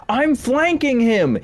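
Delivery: high, drawn-out imitation battle callout; mock shout in a high voice; mock enemy yell. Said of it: "guys will just yell out".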